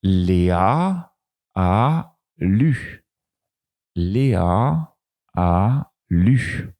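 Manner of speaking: very slow, word by word; slow, careful sounding out; slow reading demo. Shown as a demonstration. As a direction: sound out the sentence for pupils. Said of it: "je continue avec une autre phrase".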